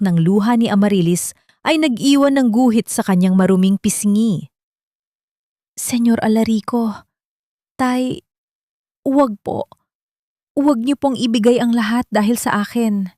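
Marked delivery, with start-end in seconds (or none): 5.8-13.1 tearful trembling plea, a touch higher; a young woman's shaky, tearful plea. A narrator's reading. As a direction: beg him through tears, voice shaking. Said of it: "Naninghinig ang boses ni Amarilis".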